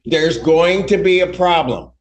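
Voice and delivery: angry. Real, not acted. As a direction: order her out, barely holding temper. Judge orders her removed.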